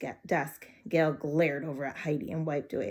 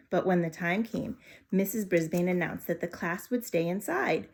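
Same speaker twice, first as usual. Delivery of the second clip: ordinary delivery, nothing marked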